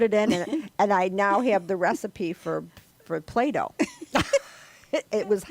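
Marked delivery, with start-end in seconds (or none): 3.8-4.4 high giggle. Real, not acted. Laughing at her Play-Doh cake story.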